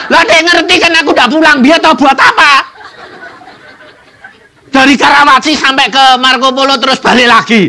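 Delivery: high, loud laughing telling. Real, not acted. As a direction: laugh through the story. Laughing at own mistake. (bercerita sambil tertawa)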